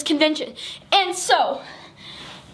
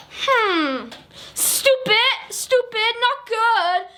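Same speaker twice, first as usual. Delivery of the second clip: higher, dismissive grunt then scoff; mock scornful leader; 6 semitones higher, much slower than usual. Soviet leader dismissing American goods, mock scorn. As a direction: sniff at it like an unimpressed boss.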